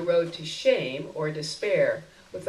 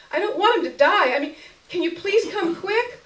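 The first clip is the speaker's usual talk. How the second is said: desperate pleading; 12 semitones higher, 7 dB louder than usual. Acted. Olivia pleading, near tears.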